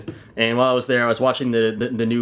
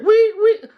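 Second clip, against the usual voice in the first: very high, loud wee-wee siren; shrill robot alarm noise, loud and high; 6 dB louder than usual. Pretend. Store robot sounding an alarm, very high siren. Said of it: "it's so fucking loud".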